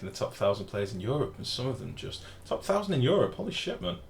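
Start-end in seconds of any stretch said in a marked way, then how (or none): 2.4-4.0 surprised. Real, not acted. Amazed at top thousand ranking.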